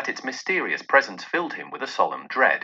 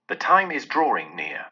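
usual speaking voice

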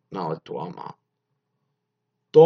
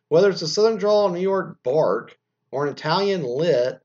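loud, higher read-aloud voice; article read; 8 semitones higher, 7 dB louder than usual. Acted. Host reading out article on accents, projected read-aloud. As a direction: project the written copy.

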